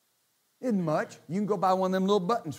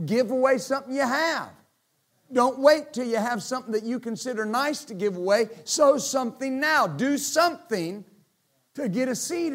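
raised, loud exhortation; preaching at full force; 7 semitones higher, 6 dB louder than usual. Acted. Preacher urging the church to give, loud and high. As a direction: push the congregation to give now.

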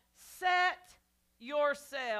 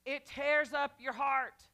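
ordinary talk, nothing marked

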